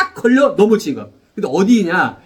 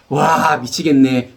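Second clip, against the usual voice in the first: mock exasperation; 7 semitones lower, much slower than usual.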